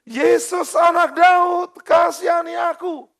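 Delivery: high, loud desperate cry. Acted. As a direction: beg at the top of your voice. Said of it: "Waktu dia berseru". (berseru keras memohon belas kasihan)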